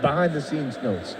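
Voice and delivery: soft, slow director's notes; professional voice. Real, not acted. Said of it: "I like your professional voice".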